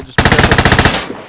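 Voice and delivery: loud urgent warning shout. Real, not acted. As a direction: yell the warning. Warning teammate in fight.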